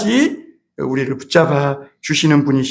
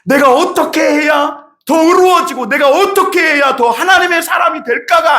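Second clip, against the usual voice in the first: much higher, loud preaching shout; impassioned shout; 10 semitones higher, 3 dB louder than usual. Real, not acted. Preacher exhorts the congregation, full-force shout.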